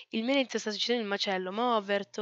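ordinary delivery

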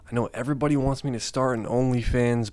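normal talking voice

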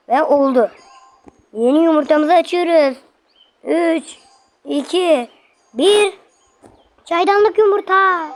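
loud, high-pitched countdown to a reveal; excited reveal. Real, not acted. Gamer counts down to opening an egg, excited.

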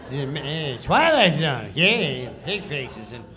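offhand, laughing Rod Serling read with lots of uhs; Norm's lazy read. Retold or imitated. Mocking how Norm read the sketch: casual, laughing, careless. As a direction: toss the intro away like you don't care. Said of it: "this is how he reads the sketch".

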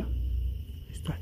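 hushed, breathless whisper; barely voiced, slow whisper. Real, not acted. Tense night in a cemetery.

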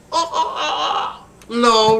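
high, drawn-out hen clucks; high puppet-hen squawking; hen clucking. Pretend.

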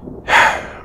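breathy, wordless sigh; uneasy sigh. Acted. Confessing discomfort to a companion, uneasy sigh. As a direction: let out a sigh mid-confession.

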